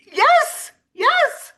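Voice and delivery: very high loud cheer; celebrating a new teammate, high and loud; excited yes. Real, not acted. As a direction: whoop it out.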